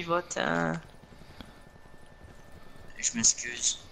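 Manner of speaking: low, soft sulking; sulky mutter. Real, not acted. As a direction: pout through the words.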